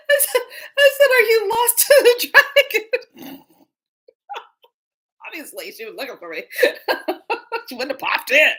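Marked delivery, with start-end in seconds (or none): 0.1-3.7 laughing hard. Real, not acted. Laughing while retelling. 6.6-8.6 laughing. Real, not acted. Laughing during story.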